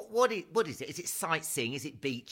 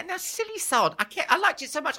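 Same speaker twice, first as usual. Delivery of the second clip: high silly voice; 14 semitones higher than usual. Pretend. Self-mocking high voice.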